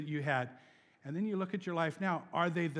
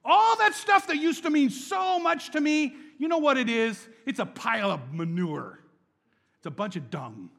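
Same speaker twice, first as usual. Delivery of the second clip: much higher and louder, gleeful Paul; a giddy Paul tossing his past away; Paul laughing off his old life as poop; excited Paul; 8 semitones higher, 10 dB louder than usual. Retold or imitated. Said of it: "He's saying".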